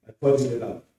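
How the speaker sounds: ordinary delivery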